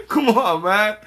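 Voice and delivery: ordinary talk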